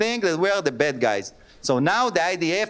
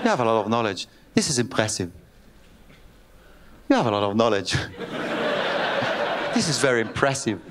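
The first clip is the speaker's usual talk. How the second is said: put-on laughing, low Scottish-accented praise; mock Scottish woman, breaking up laughing; 8 semitones lower than usual. Woman repeats her praise, laughing, in a Scottish accent. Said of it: "למה עשיתי אותה סקוטית".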